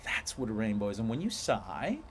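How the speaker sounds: ordinary delivery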